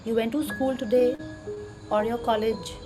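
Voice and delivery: soft, slow meditation voice; calmly walk them through gratitude; guided meditation. Acted.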